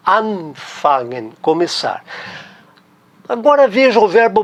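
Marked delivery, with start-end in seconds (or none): 0.1-1.3 anfangen slowly, with a drawn-out AN; stress on AN. Shown as a demonstration. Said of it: "a sílaba tônica tá no an".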